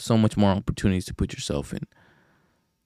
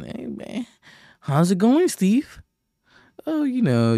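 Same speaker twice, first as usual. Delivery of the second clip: much higher mock office-buddy voice; mock coworker small talk; 12 semitones higher, 4 dB louder, slower than usual. Mocking dull coworkers greeting each other, high mock voice. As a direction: send up a bored coworker's greeting, pitched way up.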